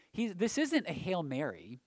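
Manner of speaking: ordinary delivery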